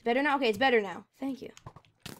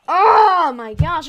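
exasperated cry; 6 semitones higher, 13 dB louder, much slower than usual. Real, not acted. Exasperated at the lag.

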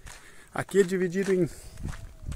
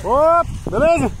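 calling out; 13 semitones higher, 7 dB louder, slower than usual. Real, not acted. Greeting someone far away.

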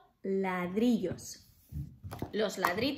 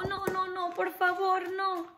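much higher frightened pleading; scared little pig; 8 semitones higher than usual. Acted. Scared pig begging the wolf, high and pleading. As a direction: beg in a tiny panicked voice. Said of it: "el cerdito estaba bien asustado".

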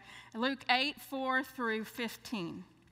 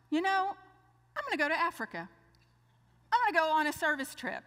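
higher, eager announcement; bright with new resolve; retold excited vow; 6 semitones higher, much faster than usual.

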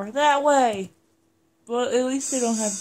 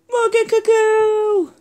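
anguished cry; 9 semitones higher, 7 dB louder, slower than usual. Acted. Crying out fallen friend's name.

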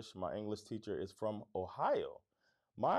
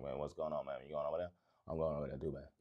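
imitating his Miami home slur, lower and relaxed; 7 semitones lower, 4 dB quieter, faster than usual. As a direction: slip into a lazy South Florida slur. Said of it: "I start talking like that".

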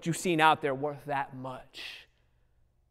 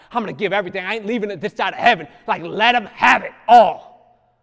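loud sermon build; preaching; 3 semitones higher, 6 dB louder than usual. Acted. Preacher urging the congregation to give everything, rising to a loud cry. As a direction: preach it, pressing the point home.

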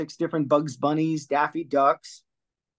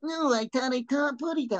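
high cartoon bird voice; Tweety voice; 10 semitones higher, 3 dB quieter than usual. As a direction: babyish cartoon canary. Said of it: "Tweety Pie".

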